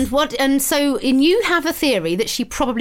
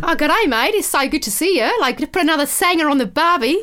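put-on higher, matey Australian accent; 3 semitones higher than usual. Presenter shows cohost how Australian is done, broad Australian accent. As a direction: greet them like a broad Australian. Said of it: "Can you do Australian?".

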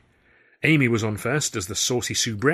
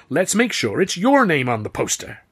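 louder, higher jovial promise; jovial impresario wrapping up; hearty showman; 6 semitones higher, 6 dB louder than usual. A narrator's reading. Grauman voiced.

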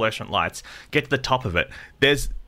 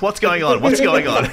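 louder, higher alarmed protest; comic, raised-voice alarm; mock panic; 4 semitones higher, 5 dB louder than usual. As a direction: play up the panic at being touched. Acts out alarm at the physio.

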